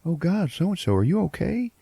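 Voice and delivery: high mock concern; hammed-up alarmed voice; worried manager. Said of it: "he's like".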